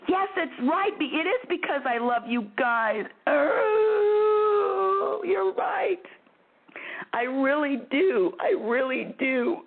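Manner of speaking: high, tearful voice breaking into a wail; crying. Real, not acted. Host overcome with love for her listeners, crying. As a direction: choke up with real happy tears. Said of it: "crying again".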